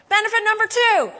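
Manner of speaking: preacher bellowing the heading; preaching at full force. Acted. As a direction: relaunch the point with force. Preacher re-launching point.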